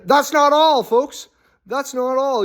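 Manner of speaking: mock announcer. Mock salesman pitch to listeners.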